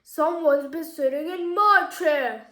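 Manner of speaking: loud papa bear storybook voice; big, loud bear indignation; booming Daddy Bear. A narrator's reading. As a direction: boom it out as the big father bear. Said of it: "in a big booming voice".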